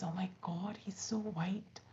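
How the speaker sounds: hushed, breathless whisper of wonder; awed whisper. Acted. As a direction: whisper it like you can't believe your eyes. Crow whispering in awe of swan.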